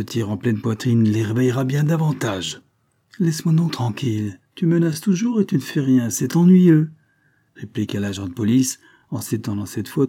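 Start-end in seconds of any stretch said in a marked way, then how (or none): 3.2-6.9 higher, louder exasperated complaint; annoyed. A narrator's reading. Detective fed up with empty threats, annoyed. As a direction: build to an irritated outburst.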